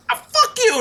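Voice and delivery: high-pitched tearful outburst, imitated; hammed-up upset singer; mock tearful. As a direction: squeal it as if in tears. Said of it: "in tears".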